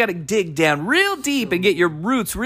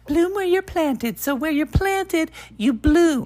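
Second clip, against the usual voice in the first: high, slow, dreamy life-coach voice; hamming up a sappy podcast host; mock inspirational; 8 semitones higher than usual. Co-host mocking the inspirational podcast voice, slow and high.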